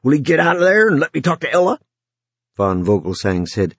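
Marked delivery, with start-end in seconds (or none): 0.0-1.8 fast, urgent insistence; demanding. Acted. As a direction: press hard for an answer. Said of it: "Runciter demanded".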